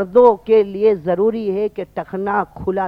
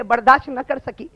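ordinary delivery

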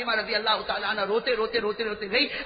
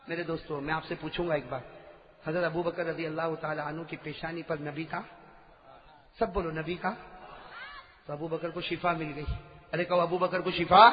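soft aside to crowd; 9 semitones lower, 8 dB quieter than usual. Real, not acted. Preacher asks crowd, dropping soft.

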